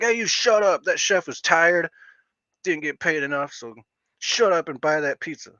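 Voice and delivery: hammed-up high scolding of a pizza complainer; mock scolding. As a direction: play a self-righteous critic telling off a customer. Imagined critic scolding a customer.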